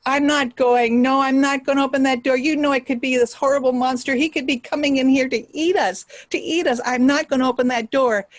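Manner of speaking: high, agitated Fox voice; acted fearful, emphatic balking; alarmed refusal. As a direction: protest in storybook panic. Fox refuses, fearing a monster.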